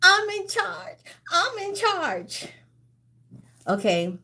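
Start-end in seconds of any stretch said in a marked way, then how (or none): 0.0-2.5 high-pitched pleading wail; acted high cry of a film character; movie lady's line. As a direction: whine it high, stretching the end. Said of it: "And she said".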